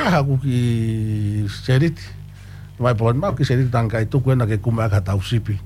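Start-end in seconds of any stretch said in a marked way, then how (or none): none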